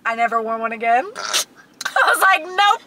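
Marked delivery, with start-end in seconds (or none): none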